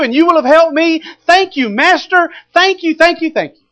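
high, loud, cocky gloating; boastful excitement. Acted. Peter bragging to Jesus about the catch, loud cocky gloating. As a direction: brag like you just struck it rich.

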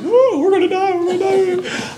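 very high, wailing mock sobs; mock crying. Big strong men running in circles, hammed-up crying. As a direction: whimper it like a panicking baby. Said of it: "like, crying".

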